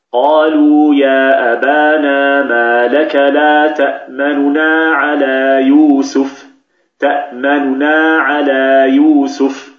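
slow Quranic chant, the vowel of تأمننا snatched and shortened; slow tajweed recitation with تأمننا said quickly and lightly; recited with ikhtilas. Shown as a demonstration. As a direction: chant it slowly, clipping the first nun's vowel in تأمننا. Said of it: "كيف ننطقها بالاختلاس؟".

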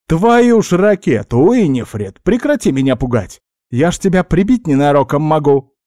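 startled outburst, starting high and loud; melodious snapping. A narrator's reading. Elf startled by a giant spider, exasperated outburst. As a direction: scold in an alarmed, rising-and-falling voice. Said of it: "рявкнул я на неё. Ну, как рявкнул? Мелодично изрёк".

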